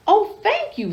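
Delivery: high, delighted little thank-you; grateful butterfly. Acted. Freed butterfly thanking Betty, high and glad. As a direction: sing out the thanks like a relieved little creature.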